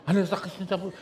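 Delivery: ordinary talk, nothing marked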